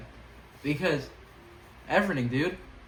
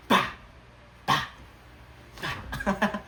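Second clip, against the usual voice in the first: hype noises; 6 semitones higher, much slower than usual. Pretend.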